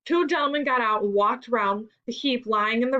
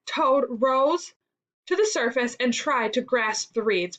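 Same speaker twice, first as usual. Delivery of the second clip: ordinary delivery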